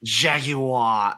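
Jaguar repeated in a mock British accent, ending in jag-yoo-wah; echoed British accent. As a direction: copy the co-host's British Jaguar. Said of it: "in a bad British accent".

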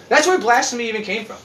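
ordinary talk, nothing marked

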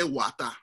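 "wata" ending in an open "ah"; "wata" imitated with a full final "ah"; Chombo pronunciation. Shown as a demonstration. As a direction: drop the r in "wata" as in Chombo English. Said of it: "the Chombo people say wata".

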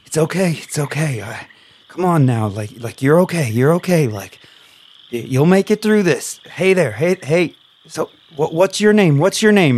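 calm her down, then ask her name; lightly voiced reassuring captive. Bound captive Michael soothing a frightened newcomer, gently. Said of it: "And he's like".

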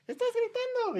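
very high falsetto self-accusation; imagined inner voice. Retold or imitated. Comic imagining his friend scolding himself inside, high mock inner voice. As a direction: squeak it like an alarmed inner voice. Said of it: "diciéndote a ti mismo".

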